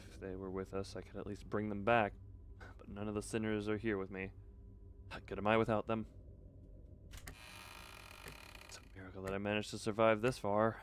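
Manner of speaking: low, soft, sombre reading; subdued narration. Acted. Narrator alone without the sinners, soft low reading. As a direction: read it softly as a downcast inner monologue. Reads the narrator's inner monologue.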